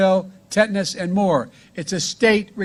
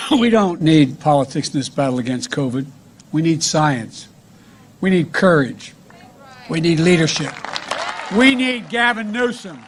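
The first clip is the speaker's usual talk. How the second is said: ordinary talk, nothing marked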